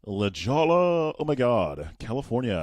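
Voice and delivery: put-on high, drawn-out California valley-girl voice; hammed-up Californian, stretched and high; mock California accent. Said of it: "Do you want to say it like California?".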